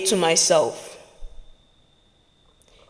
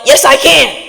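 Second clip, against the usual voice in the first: louder, higher defiant yell; yell back; 4 semitones higher, 4 dB louder than usual. Shown as a demonstration. Speaker yelling back at the negative voice, defiant shout. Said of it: "You have to yell".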